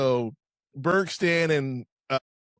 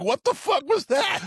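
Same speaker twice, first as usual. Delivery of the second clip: shouted quote. Retold or imitated. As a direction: bellow it like a baffled jock. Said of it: "And Ogre's like".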